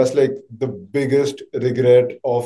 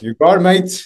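badly faked Australian accent, pitched higher; fake Australian accent; 4 semitones higher, 3 dB louder than usual. Shown as a demonstration. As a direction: have a poor go at sounding Australian. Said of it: "fake Australian by his accent".

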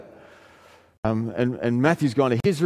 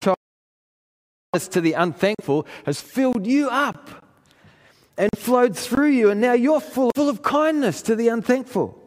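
full-force preaching; 10 semitones higher than usual. Acted. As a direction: lift the congregation with rising fervor. Preaching climax, rising fervor.

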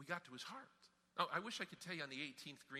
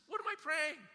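imitating a baffled golfer, high and loud; incredulous question; 12 semitones higher, 8 dB louder than usual. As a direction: pitch it up in mock disbelief.

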